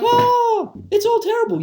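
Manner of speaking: high-pitched mock lament; exaggerated, high whiny imitation; mocking wail. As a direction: imitate a hand-wringing complainer, voice way up. Mocks people on media bandwagon.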